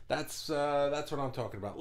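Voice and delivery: ordinary delivery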